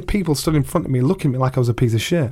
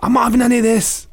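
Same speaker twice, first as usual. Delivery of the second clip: panicked quitter; 9 semitones higher, faster than usual. Retold or imitated.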